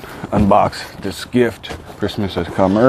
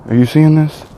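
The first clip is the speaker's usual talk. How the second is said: amazed; 7 semitones higher, 5 dB louder than usual. Real, not acted. Amazed at gift contents.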